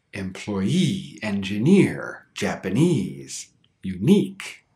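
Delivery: "Employee, engineer, Japanese, unique" each stressed on the last syllable; stress on the suffix. Shown as a demonstration. Teacher showing exceptions, "Employee, engineer, Japanese, unique" stressed on the final syllable. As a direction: land hard on EE, NEER, NESE, NIQUE in "Employee, engineer, Japanese, unique". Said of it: "stress will always be on the suffix".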